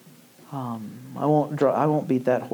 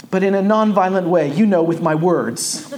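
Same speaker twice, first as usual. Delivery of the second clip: put-on higher, louder earnest little boy; earnest child; 6 semitones higher, 6 dB louder than usual. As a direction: voice the son's careful correction. Son's earnest afterthought voiced.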